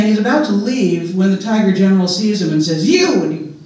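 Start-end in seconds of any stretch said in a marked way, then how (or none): 2.9-3.2 calling out. Acted. Tiger general spots mouse deer.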